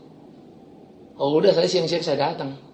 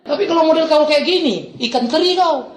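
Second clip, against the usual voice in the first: scornful scolding; 10 semitones higher, 5 dB louder than usual. Retold or imitated. Acted belittling of the thug.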